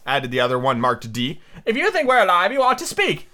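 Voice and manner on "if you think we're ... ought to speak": high-pitched, put-out storybook twin; high character voice, indignant; indignant Tweedledee. Acted. Tweedledee's retort, acted.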